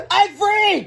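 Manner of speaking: very high, loud cry of relief; excited cry. A narrator's reading. Freed woman cries out, high and loud. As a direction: shout it out way up high. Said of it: "cried Miss Anthorp".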